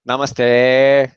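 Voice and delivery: NAMASTE with the last vowel held long and loud; long final vowel. Shown as a demonstration. Showing how to keep it long, NAMASTE drawn out at the end. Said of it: "you need to keep it long like this".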